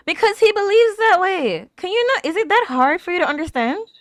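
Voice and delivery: high, loud exasperated rant. Real, not acted. Viewer scolding Zeke on screen, loud and exasperated. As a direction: lecture the screen in frustration.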